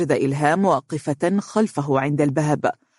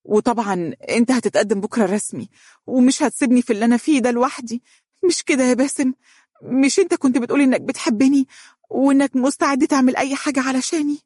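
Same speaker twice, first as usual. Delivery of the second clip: high, anxious plea to a lover; pleading young woman; 9 semitones higher, faster than usual. Acted. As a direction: plead in a raised, pressing voice.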